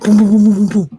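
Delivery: loud, rapid "boom boom" noise; imitated booming. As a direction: thump out the booms loudly with the voice. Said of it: "don't let me start singing. They be like".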